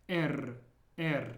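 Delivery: "R" rolled as in Spanish. Shown as a demonstration. Teacher giving an example of the Spanish-like rolled R. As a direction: say "R" with a trilled tongue, not an English r. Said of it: "how you roll your R's in Spanish".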